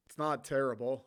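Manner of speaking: normal talking voice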